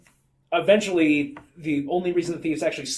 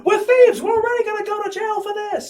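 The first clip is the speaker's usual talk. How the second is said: very high mock shriek; hammed-up thief screaming at his partner; arguing crook; 8 dB louder than usual. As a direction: yell it in pretend exasperation. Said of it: "having this conversation like".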